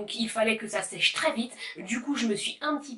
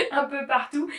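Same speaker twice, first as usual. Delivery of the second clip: laughing while explaining; 5 semitones higher, 6 dB louder than usual. Real, not acted. Laughs at herself mid-demo.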